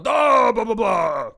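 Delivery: high Skyrim dragon shout; mock battle scream. Student leans into a stomp to intimidate teacher, mock screaming. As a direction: bellow a hammed-up shout, falling away into nonsense. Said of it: "screams at the same time".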